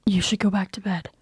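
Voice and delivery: hushed whisper, coaxing him back to sleep; gentle whisper. Acted. Shadow urging Cookie back to sleep, gentle whisper. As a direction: whisper it softly.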